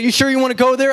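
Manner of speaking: a hint of a threatening umpire, pitched high; intimidating umpire. Umpire trying to intimidate the coach, a mild mock threat. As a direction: lean in and dare the coach. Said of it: "wants to start getting intimidating".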